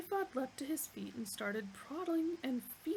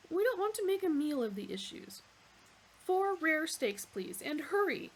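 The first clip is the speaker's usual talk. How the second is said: loud, pushy, higher; impatient demand; 4 semitones higher, 4 dB louder than usual. Acted. Zaphod ordering steaks from the waiter, impatient. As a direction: brush the issue aside and order.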